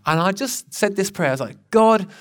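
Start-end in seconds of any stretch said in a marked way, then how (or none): 1.7-2.1 a loud, high cry of God; sudden loud plea to God. Retold or imitated. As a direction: call out God as if desperate. Retold desperate prayer cry.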